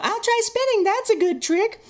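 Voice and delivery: high, fast, goofy kid voice mocking young Anakin. Reviewer mocks Phantom Menace Anakin in a high goofy kid voice. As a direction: play the bumbling boy pilot, squeaky and eager. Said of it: "shades of Anakin in Phantom Menace".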